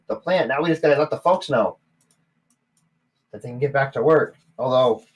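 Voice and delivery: high, loud grateful gnome; gnome barkeep. Acted. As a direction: thank the newcomers warmly. Gnome thanks party.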